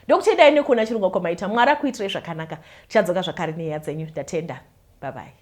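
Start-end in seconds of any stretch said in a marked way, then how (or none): none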